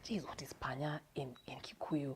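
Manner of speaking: panicked whisper; whispered to herself. Retold or imitated. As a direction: hush it like a secret worry. Frantic inner thought, unvoiced.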